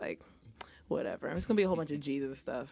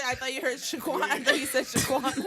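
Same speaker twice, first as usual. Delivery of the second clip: laughing; 8 semitones higher, 5 dB louder than usual. Real, not acted.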